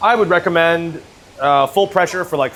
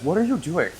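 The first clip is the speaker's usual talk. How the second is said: normal talking voice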